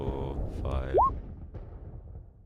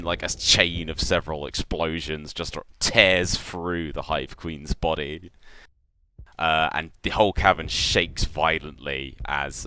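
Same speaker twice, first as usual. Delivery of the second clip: ordinary talk, nothing marked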